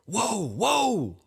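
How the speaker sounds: high, alarmed, voiced stage whisper; loud urgent hushing. A narrator's reading. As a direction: hush someone in alarm, pitched high. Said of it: "he whispers to Nathan loudly".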